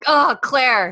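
loud, high mock groan of dismay; exasperated. Viewer despairing at Claire's bad lying, exasperated.

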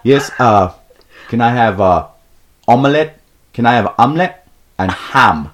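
loud, halting bad Mexican accent; poor fake Mexican accent. Storyteller imitates friend ordering omelet, poor fake Mexican accent. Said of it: "with a poor fake Mexican accent".

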